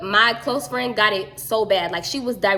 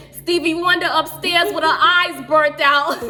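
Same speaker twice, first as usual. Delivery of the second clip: higher, louder mock Massachusetts accent; 6 semitones higher, 6 dB louder than usual. The mom joking about the blinded friend, mock Massachusetts accent. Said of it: "she from Massachusetts".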